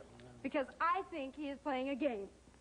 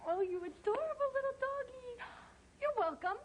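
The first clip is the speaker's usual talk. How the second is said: high, sugary puppy-praise; gushing baby talk; 8 semitones higher than usual. Acted. Woman returns the pickle to the puppy, cooing. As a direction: coo adoringly in a high voice.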